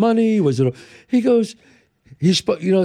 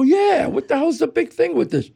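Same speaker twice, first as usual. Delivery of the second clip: put-on curious kid; 10 semitones higher than usual. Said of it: "I go".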